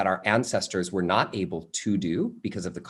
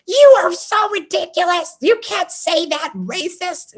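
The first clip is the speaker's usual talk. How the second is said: put-on much higher, shocked old aunt telling him off; mock-scandalised aunt, pitched way up; old aunt scolding; 5 dB louder, slower than usual. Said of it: "she's like".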